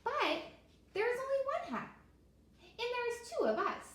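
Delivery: squeaky, fretting over the one hat; worried turtle. Acted. Turtle notices the problem.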